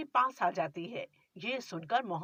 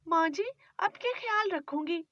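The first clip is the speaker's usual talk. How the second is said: put-on higher, pleading young wife; young bride; 7 semitones higher than usual. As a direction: defend yourself meekly to your mother-in-law. Bride apologises.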